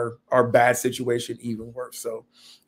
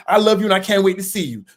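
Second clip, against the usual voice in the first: louder, snippy mock attitude; 6 semitones higher, 6 dB louder than usual. Partner reads the loving text with a mock attitude. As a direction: read it back as if annoyed. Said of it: "Why you gotta say with an attitude".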